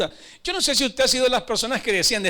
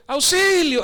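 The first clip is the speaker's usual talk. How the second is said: high, loud cry of distress from a pit; acted shout for help; 6 semitones higher, 6 dB louder, slower than usual. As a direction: cry out for rescue, raised in pitch and volume. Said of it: "Empieza a gritar".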